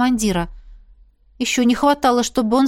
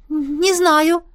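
higher, timid confession; honest little admission; 7 semitones higher than usual. A narrator's reading. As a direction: admit it sheepishly. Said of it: "честно призналась". (робкое честное признание)

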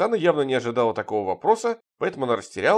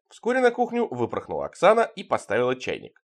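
usual speaking voice

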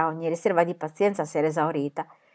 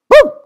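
short, punchy "boom"; sudden imitated whack; imitated blow; 16 dB louder than usual. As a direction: thump sound made with the voice. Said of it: "gli detti un colpo in testa".

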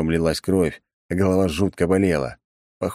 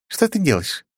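higher woman's voice, puzzled; Fuori's voice; 13 semitones higher than usual. A narrator's reading. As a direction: voice her as a curious girl. Fuori asks, dialogue.

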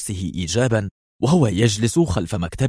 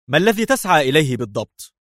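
high, pressing angry question; mildly acted anger; 7 semitones higher than usual. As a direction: demand an answer in anger. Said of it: "قال برونو في غضب".

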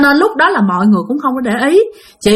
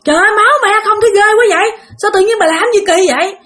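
high-pitched, hurried horrified scolding; frantic husband, pitched way up; alarmed outcry; 9 semitones higher, faster than usual. Acted. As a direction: act out crying out in horrified disbelief. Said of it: "Cái ổng mới la làng luôn".

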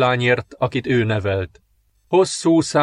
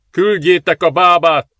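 loud, higher-pitched shouted order; calling out; 6 semitones higher, 6 dB louder than usual. A narrator's reading. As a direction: call out urgently to the servants. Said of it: "kiáltott ki a szolgáknak". (kikiáltva a szolgáknak)

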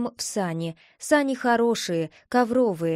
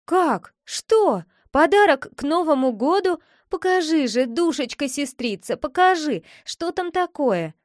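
higher-pitched burst of surprised curiosity; excited, pleading; 5 semitones higher than usual. Acted. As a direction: react eagerly, then coax your sister. Boy thrilled by hint of gift.

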